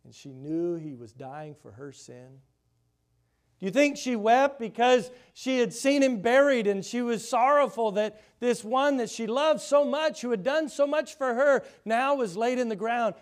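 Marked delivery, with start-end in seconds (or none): 3.6-13.1 higher and louder, pleading question; impassioned preaching. Real, not acted. Preacher asking the congregation about Mary, impassioned and loud. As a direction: press the grief home to the listeners.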